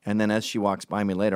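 ordinary delivery

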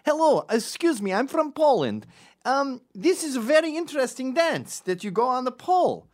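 high, loud fake Polish accent; enthusiastic Polish tourist. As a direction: gush like an eager Polish tourist. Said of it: "pretend to be really enthusiastic".

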